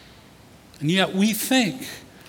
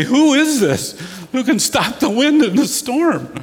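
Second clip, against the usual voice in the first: higher-pitched, imitated alarm and disbelief; acted freaked-out awe; 7 semitones higher, 3 dB louder than usual. Disciples after Jesus stills the storm, freaked out. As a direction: ask it like a badly rattled onlooker. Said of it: "they're even more freaked at that point".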